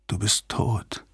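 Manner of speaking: very soft, voiceless whisper. A narrator's reading. Inner voice tells David he is dead, whispered. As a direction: whisper it as a voice inside the head.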